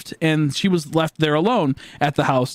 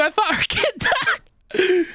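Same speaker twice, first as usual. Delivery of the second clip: laughing; much slower than usual. Real, not acted. Laughing at his own story.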